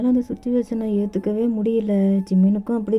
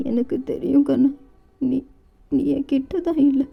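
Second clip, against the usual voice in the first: higher, tearful grieving voice; crying mother; 4 semitones higher than usual. Acted. Mother tearfully speaking to her dead son, higher pitch. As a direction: speak to her lost son through tears. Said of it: "அழுதுகிட்டு".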